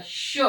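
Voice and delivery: "श" with a strong hiss, full tongue, air pushed hard; forceful sh. Shown as a demonstration. Teacher showing pupils the pressure needed for "श". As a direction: push air firmly out on "श". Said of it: "श को बोलते समय हमको थोड़ा जोर देना पड़ता है".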